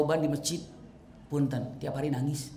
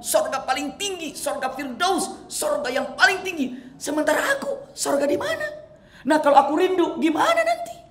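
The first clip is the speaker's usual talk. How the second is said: anguished worry; 10 semitones higher, 4 dB louder than usual. Acted. Thauban's tearful worry retold.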